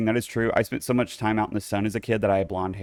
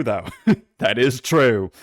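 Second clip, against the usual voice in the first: slow, louder laughing that is true; laughing confirmation; 5 dB louder, slower than usual. Shown as a demonstration. Confirming toe result to viewers, slow laughing. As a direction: laugh through it, amused. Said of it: "It is true".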